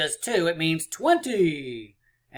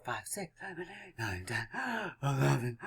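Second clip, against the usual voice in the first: soft, fading count with puffs; mock laboured counting; 8 dB quieter than usual. Teacher showing counting all the dots, mock exhausted. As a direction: act worn out by counting.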